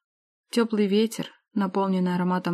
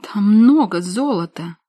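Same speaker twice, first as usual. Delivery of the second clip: stunned; 2 semitones higher, 9 dB louder, slower than usual. Acted. Sister stunned by gold.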